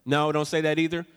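usual speaking voice

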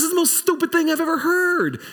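Sarah scoffing at God's promise, high and quick. Retold or imitated. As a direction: toss it off like she can't believe the nonsense. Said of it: "She goes".

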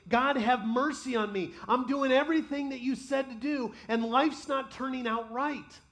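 godly people crying out to God, loud and pleading. Acted. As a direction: cry out for mercy. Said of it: "are crying out saying".

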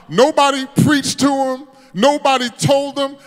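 full-force preaching. Acted. Preacher telling the killer's conversion, full-force shouted preaching.

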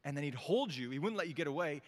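ordinary talk, nothing marked

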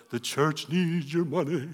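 high, drawn-out wavering appeal; mock money plea. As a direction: stretch it out like a hammed-up church plea. Mock fundraising plea he disowns.

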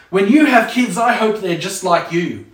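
put-on an angry parent's threat to a defiant child; threatening parent. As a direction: wish it on them with venom. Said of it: "the threatening ones".

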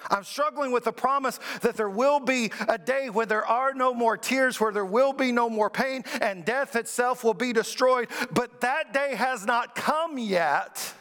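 high-pitched, urgent lament to God; impassioned preaching. Acted. Preaches the psalmist's lament at raised pitch.

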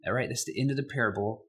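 normal talking voice

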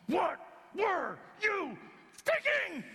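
high, slow shouted scolding; exasperated shout. Retold or imitated. Mother yelling at her runaway son. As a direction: let the frightened mother explode. Mary scolding found Jesus.

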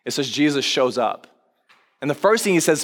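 usual speaking voice

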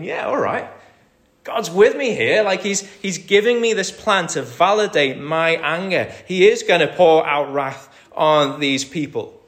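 high, loud, triumphant gloat; gloating Jonah. Retold or imitated. Preacher voicing Jonah, gleeful that God backs his anger. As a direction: crow over the coming wrath like a smug prophet.

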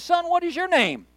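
high-pitched showman healer's question; faith healer. Acted. Faith healer questioning the next man, mock showy high call. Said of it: "a faith healer that stands up".

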